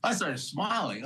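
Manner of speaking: normal talking voice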